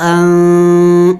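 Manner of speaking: ان held with the nun hidden in the nose; sustained nasal ان; nasal ikhfa. Shown as a demonstration. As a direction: hum the ن of ان through the nose. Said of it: "یعنی ناک میں آواز چھپائیں گے".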